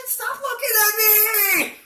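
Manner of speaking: very high, squealed mock outburst; hammed-up panicked shriek; mortified shriek. Patient being examined by the doctor, mortified shriek.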